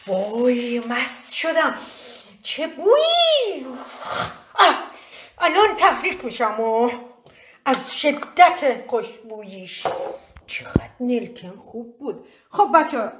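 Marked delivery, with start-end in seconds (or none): 0.1-9.9 camp it up, dizzy with the smell; hammed-up swoon. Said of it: "ادا اطفال بسه". 10.5-12.3 slow hushed whisper of longing; mock whisper. Whispering about the cloves to viewers, slow mock whisper. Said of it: "ادا اطفال بسه".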